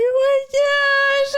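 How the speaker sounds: very high, loud, stretched wail; retold freak-out. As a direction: draw it out in mock hysteria. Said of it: "freaking out and everything".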